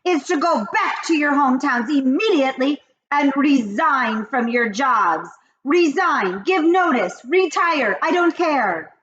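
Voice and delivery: high, loud witch rant. Acted. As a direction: rage like the Grand High Witch. Grand High Witch speech.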